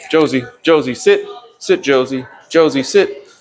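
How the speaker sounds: high calling of the name, sit said over and over; repeated nagging command. Shown as a demonstration. Trainer to dog Josie, repeating the command too often. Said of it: "she's not really paying much attention".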